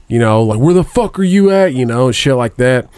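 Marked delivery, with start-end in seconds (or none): 0.6-1.7 retold yell. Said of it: "they start yelling".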